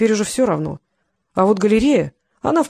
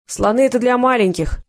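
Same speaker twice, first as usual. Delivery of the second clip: mildly self-important, a little higher; solidly self-important; 4 semitones higher than usual. A narrator's reading. Boy dismissing elephants as kid stuff, mildly self-important. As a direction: answer with a touch of grown-up importance. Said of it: "солидно ответил Вася".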